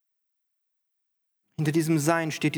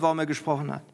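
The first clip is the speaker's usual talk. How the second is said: ordinary talk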